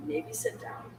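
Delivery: hushed whisper to the player; whispered aside. Retold or imitated. Whispering to the angry player to sit down. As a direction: lean in and whisper it.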